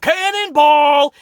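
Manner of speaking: drawn-out, high, loud yell; imitated scream. Retelling Otis waking Mandy with a pool jump, imitated scream. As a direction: holler it out long and high. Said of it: "literally screams out".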